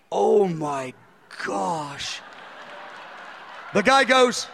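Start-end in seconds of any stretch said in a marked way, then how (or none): 0.1-2.2 low, hushed, overwhelmed gasp of realization; retold moment of being moved near tears; softly stunned, voice dropping away; tearing up, awestruck. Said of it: "I begin to tear up".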